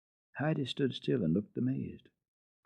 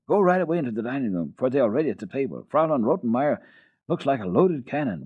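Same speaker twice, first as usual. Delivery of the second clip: fast, louder hurry; urging; 4 semitones higher, 5 dB louder, faster than usual. Acted. Butler hurrying Heidi to the table, urging fast. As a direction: rush her along. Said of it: "urged Sebastian again".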